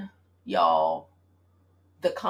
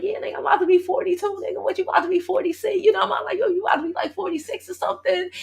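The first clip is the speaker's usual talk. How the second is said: excited teasing; 11 semitones higher, faster than usual. Retold or imitated. Teasing her ex about his age, high and excited. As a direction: rib him about being older.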